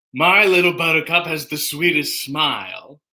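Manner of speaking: spoken sitcom nemesis impression; Newman from Seinfeld. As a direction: recite the lyric in character, without singing. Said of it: "is Newman from Seinfeld".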